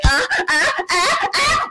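high-pitched imitated moans, climbing; mock her moans in rising squeals; exaggerated fake moaning. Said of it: "Then she was like".

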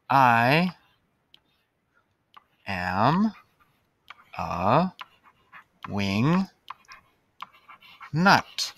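spaced out word by word; slow dictation. Shown as a demonstration. Teacher writing on the board, word by word. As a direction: say it slowly while writing it.

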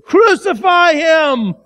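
high, loud, drawn-out cry; slow shouted demand; crowd's cry. A narrator's reading. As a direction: cry it out like a mob. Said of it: "and they said".

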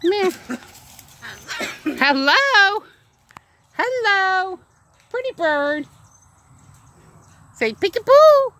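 teaching the parrot words in a high voice; high pet voice. Real, not acted. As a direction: coax the bird in a high lilt.

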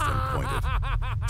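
high, slow laughter through the words; laughing. Acted. King mocks the shamed men, laughing high and slow. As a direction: laugh at them while you point. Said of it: "He laughed".

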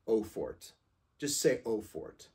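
eau fort as "oh fort", T sounded. Shown as a demonstration. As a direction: say eau fort simply, like O plus fort. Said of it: "you would just say fort".

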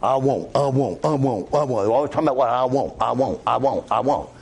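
imitating his Southern relatives' whiny drawl; lightly mocking Southern family drone; preacher mimicking Southern relatives' greedy whining, in a mild Southern drawl. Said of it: "they were Southern, so this is the way they said it".

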